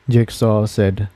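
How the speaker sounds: usual speaking voice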